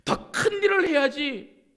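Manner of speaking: imitated stern telling-off; demanding rebuke. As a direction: press a servant to do better, high and forceful. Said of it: "이렇게 말씀하신 거 아니에요".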